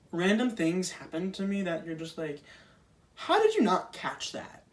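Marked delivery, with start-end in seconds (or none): 3.2-4.6 much higher, incredulous ask; baffled high question. Pretend. Asking doctors how they missed it, incredulous and high. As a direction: sound truly baffled.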